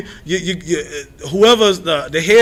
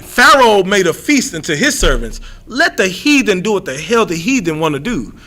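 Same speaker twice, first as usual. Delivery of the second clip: normal talking voice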